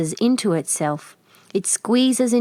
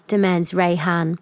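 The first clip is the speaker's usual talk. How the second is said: usual speaking voice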